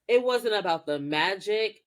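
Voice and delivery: ordinary talk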